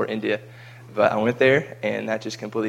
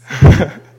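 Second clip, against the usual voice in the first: loud laugh; 5 semitones higher, 18 dB louder than usual. Real, not acted. Laughing at own memory.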